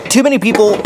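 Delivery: loud, high announcement to chat. Shown as a demonstration. Loud pitch to the stream crowd, loud. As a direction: belt out the opening line. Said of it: "this will be real loud for you".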